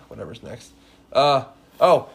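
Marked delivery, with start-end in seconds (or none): none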